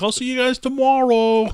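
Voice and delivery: high, loud sing-song farewell; sing-song old lady. Retold or imitated. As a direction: wave goodbye in a high chirp. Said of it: "just waving as she's leaving".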